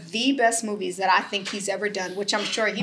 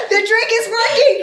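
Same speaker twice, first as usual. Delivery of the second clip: loud, high cheer; excited shout; 11 semitones higher, 9 dB louder than usual. Real, not acted. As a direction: yell it in triumph. Cheering the drink's effect.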